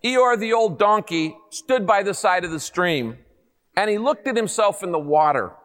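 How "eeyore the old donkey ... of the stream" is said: ordinary talk, nothing marked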